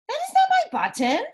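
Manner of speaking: high, loud shout from Toad. Acted. Toad shouting at Frog over the wrong button, high and loud. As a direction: yell it out in a frustrated fit. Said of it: "shouted Toad".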